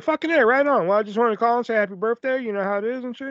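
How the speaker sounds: animated casual patter; upbeat phone chat. Retold or imitated. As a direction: re-enact a breezy friendly call. Retold casual friendly phone chat.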